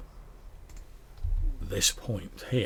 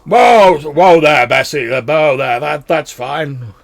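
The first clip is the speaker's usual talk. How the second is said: dwarf calling horse; 6 semitones higher, 15 dB louder, slower than usual. Acted. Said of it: "he's like".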